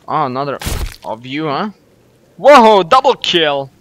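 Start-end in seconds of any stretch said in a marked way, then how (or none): 2.4-3.7 loud, drawn-out celebration; slow, booming victory call; triumphant shout. Real, not acted. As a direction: crow over the kill, stretching it out. Celebrates a kill in game.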